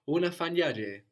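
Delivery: "unafanya je" as one word, stressed on "nya"; accent moved to NYA. Shown as a demonstration. Teacher models the joined question word, stress shifted to "nya" in "unafanya je". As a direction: run "je" onto "unafanya" and punch the "nya" before it. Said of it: "we hear that 'cause the accent moves".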